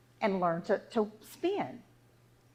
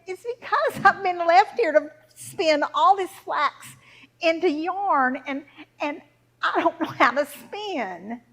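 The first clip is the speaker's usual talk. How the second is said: put-on distressed girl; 4 semitones higher, 6 dB louder than usual. Girl telling little woman her plight, upset and raised voice. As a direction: plead helplessly, stretching the words.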